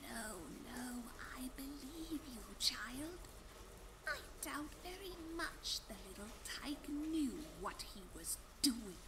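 bitter whisper. Acted. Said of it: "Her whisper was bitter".

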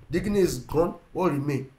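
ordinary delivery

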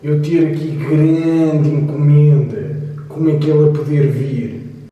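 put-on slow, heavy Russian accent; thick Russian accent, drawn out. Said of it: "para de falar com esse sotaque russo".

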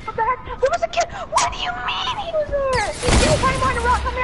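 high-pitched outraged yelling; shocked shout. Real, not acted. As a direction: shout in disbelief. Shocked at hidden enemy.

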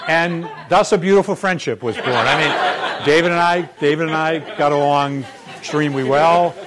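can't stop chuckling while telling it; laughing. Real, not acted. Dean laughing to the audience about his old colleague.

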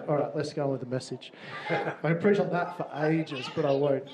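normal talking voice

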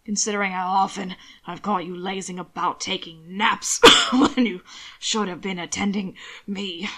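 a hint of a chiding master, interrupted by a cough; lightly voiced Roland figure. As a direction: mildly scold a slacking underling. Ill employer scolds his man.

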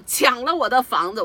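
loud, high indignant cry; angry shout. Retold or imitated. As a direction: shout the grievance at the police. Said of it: "我当时就气怒了". (愤怒地高声喊)

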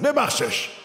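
sudden high-pitched cry of a command; God's urgent shout, acted out; retold shout. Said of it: "خداوند در قلبم فریاد زد".

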